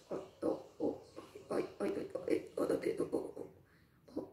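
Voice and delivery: whispered, breathless stammering; panicked whisper. Acted. Duck hears the wish story, whispered panic. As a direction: gasp out the words in shock.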